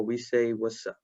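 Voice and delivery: usual speaking voice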